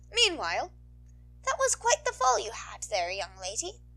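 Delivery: put-on old nurse. School nurse tending a woman's ankle, fussing. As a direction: scold gently while checking. Voices Recovery Girl.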